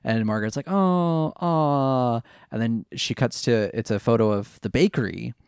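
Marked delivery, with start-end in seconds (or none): 0.7-2.2 put-on cooing; fake interest. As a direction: feign polite admiration of photos. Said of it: "it's very fake".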